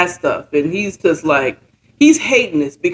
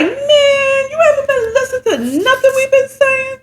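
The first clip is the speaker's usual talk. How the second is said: incredulous associate. Retold or imitated. Associate's exasperated retort quoted.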